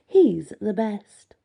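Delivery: slow, emphatic inner wolf; the wolf insists, slow and sure. A narrator's reading. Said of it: "My wolf inside my mind says".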